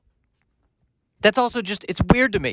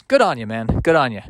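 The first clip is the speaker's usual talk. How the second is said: Australian accent attempt; 6 semitones lower than usual. Real, not acted. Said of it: "I don't know why I'm Australian there".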